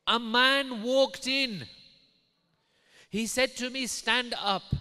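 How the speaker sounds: high, loud, forceful proclamation; dramatic preaching. Retold or imitated. Wife tells husband of a stranger's visit, dramatic proclamation. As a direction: announce the apparition at full force.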